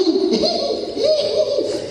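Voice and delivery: laughing in a very high pitch; high squealing laugh. Real, not acted. Laughs after his own joke.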